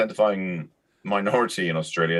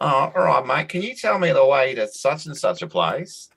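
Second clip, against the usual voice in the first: higher-pitched imitation of an Australian old-timer; Australian accent; 7 semitones higher than usual. Sun-baked little old dude stops a visitor for directions, imitated Australian accent. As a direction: put on an Australian local's drawn-up, high-pitched accent. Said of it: "You do that accent really well".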